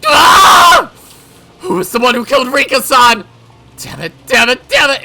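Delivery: high, loud screaming demand; frantic rage. Acted. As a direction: scream it while searching. Mion hunts Rika's killer.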